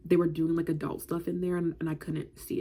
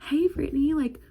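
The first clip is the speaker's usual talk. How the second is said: put-on eager little kid; 4 semitones higher than usual. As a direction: beg to join in like a small child. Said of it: "I went up to them and I was like".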